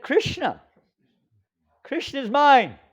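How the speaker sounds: high, loud declaration; fervent quote. Retold or imitated. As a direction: proclaim it with devotion. Quoting Prabhupada with fervour.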